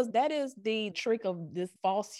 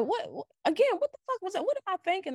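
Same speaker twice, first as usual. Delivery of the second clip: incredulous; 12 semitones higher, faster than usual. Retold or imitated. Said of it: "And now it's like".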